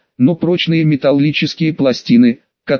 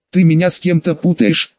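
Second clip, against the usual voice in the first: usual speaking voice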